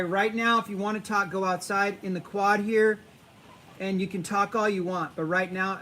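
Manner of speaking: normal talking voice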